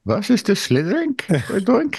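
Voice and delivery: bad German villain accent; hammy German accent; movie villain german accent. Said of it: "this bad German accent I picked up".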